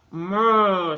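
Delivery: loud drawn-out moo. Acted. The cow answers from under the mask, loud moo. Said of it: "says the cow".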